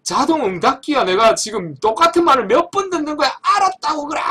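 mock the boss's outburst; retold angry yelling. Retelling the boss yelling at the agent, heated. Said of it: "이러면서".

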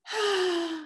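high, airy character sigh; big storybook sigh. A narrator's reading. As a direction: let out an audible acted sigh. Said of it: "Rocky sighed".

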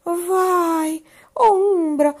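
high, loud, fast panicked words; high loud fast frightened whisper-cry; terrified report. A narrator's reading. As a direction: blurt out what she saw in terror. Minerva saw a shadow, terrified.

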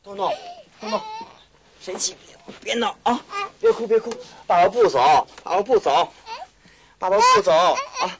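put-on high, coaxing comfort to a sobbing boy; soothing a crying child. Father hushing his crying son as he leaves, soothing and high. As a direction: hush him gently, pitch lifted.

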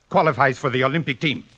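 ordinary talk, nothing marked

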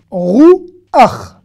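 "Rouach" in two loud beats, rou-ACH; chet read as ach. Shown as a demonstration. Teacher demonstrating the end of a word, said ach. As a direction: end "Rouach" on vowel then guttural ch, never "cha". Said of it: "Le chet avec un a à la fin d'un mot se prononce".

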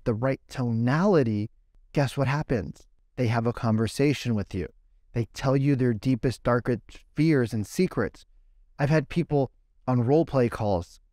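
usual speaking voice

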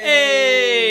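loud cheerful yell; shout. Real, not acted. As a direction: call out to the room. Greeting the boys.

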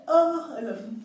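ordinary delivery

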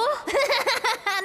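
mocking laugh.

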